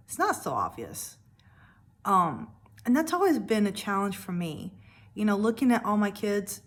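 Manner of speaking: normal talking voice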